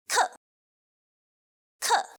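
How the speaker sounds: "Ke" short, falling suddenly; fourth tone. Shown as a demonstration. Teacher introducing fourth tone, "Ke" falling sharply. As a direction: start "Ke" high and drop it sharply. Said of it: "is the sudden fall of the sound".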